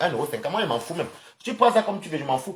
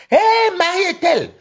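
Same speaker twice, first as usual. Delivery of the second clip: calling out; 7 dB louder than usual. Real, not acted. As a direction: call out to a friend far away. Greets viewer joining the live.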